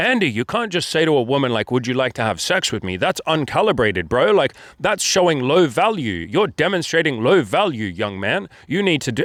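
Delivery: singsong mock scolding with swooping pitch; mock lecturing. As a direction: parody a know-it-all critic telling someone off. Mocking critical coaches in comments.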